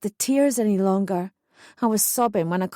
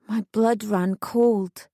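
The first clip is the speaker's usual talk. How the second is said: usual speaking voice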